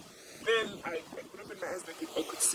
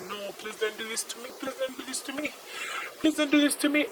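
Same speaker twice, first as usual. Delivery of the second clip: desperate pleading; 7 semitones higher than usual. Retold or imitated. Retold pleading with attacker.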